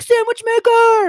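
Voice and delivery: high, loud, slow eager call; kids' eager call. Retold or imitated. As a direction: call it out like excited kids. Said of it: "they'd be like".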